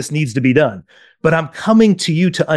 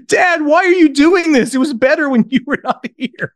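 put-on high, whiny child's protest; crying kids imitated; 11 semitones higher than usual. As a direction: wail it like an upset kid, acted. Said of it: "the other kids are crying and saying".

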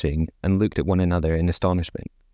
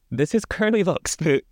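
horrified cry; 8 semitones higher than usual. A narrator's reading. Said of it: "he cried in horror".

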